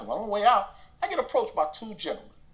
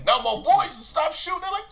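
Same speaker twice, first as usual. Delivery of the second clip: usual speaking voice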